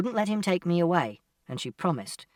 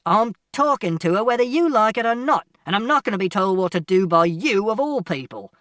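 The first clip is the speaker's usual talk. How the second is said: loud, raised-pitch defiant father; angry shout; 5 semitones higher, 7 dB louder than usual. A narrator's reading. Father confronting people in the flat, shouting angrily. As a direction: shout down the others furiously. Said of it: "And Father shouted".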